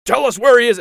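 an Inquisitor reining in his partner, loudly; mock commanding shout. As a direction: cut her off with a loud command. Other Inquisitor cuts her off.

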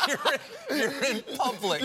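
wife scolding husband crying in public, high and slow. Retold or imitated. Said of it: "She goes".

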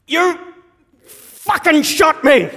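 high, loud, drawn-out yell of disbelief; outraged shout. Retold or imitated. Shot dad to the child who did it, shouting in outrage. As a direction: bellow it slowly, stretching each word.

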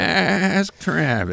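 slow, lightly voiced old person. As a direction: drag it out like an old-timer. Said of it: "It's my old person voice".

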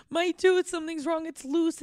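put-on high, worried little-girl voice; mock crying child. Daughter waking her aunt about a loose tooth, imitated crying upset. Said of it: "she woke up crying".

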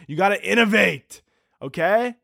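mock motivational pitch; mock lecture. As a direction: preach innovation like a smug boss.

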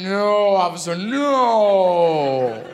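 long drawn-out, exaggerated no; mock-earnest denial. Cyclist answering the policeman's taunt, exaggerated denial. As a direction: stretch the denial right out.

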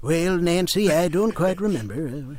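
lightly voiced, vague old president; a hint of a muddled Reagan; Ronald Reagan. A hint of Reagan talking to Nancy, muddled. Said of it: "Ronald Reagan".

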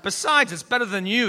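ordinary talk

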